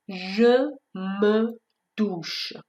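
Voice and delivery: "Je me douche" word by word with schwa; conjugation drill. Shown as a demonstration. Teacher conjugating the verb for students: slow, separate.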